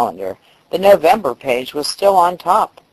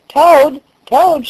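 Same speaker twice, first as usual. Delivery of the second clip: high, loud call through a door; shouting; 11 semitones higher, 5 dB louder than usual. A narrator's reading. Frog shouting at Toad's front door. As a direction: call out to wake a friend. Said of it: "shouted Frog".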